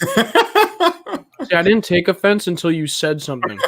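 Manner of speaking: laughing through the words. Real, not acted. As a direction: talk while laughing, pitch up. Laughing at friend's jab.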